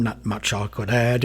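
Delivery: usual speaking voice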